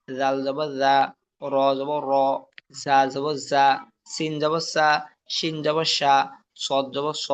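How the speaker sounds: item by item, pausing after each; measured letter-by-letter recitation with gaps; spelling drill. Shown as a demonstration. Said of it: "যেমন". (থেমে থেমে বানান করে পড়া)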